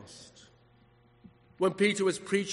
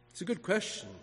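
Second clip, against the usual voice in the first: ordinary talk, nothing marked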